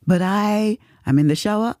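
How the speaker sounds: ordinary delivery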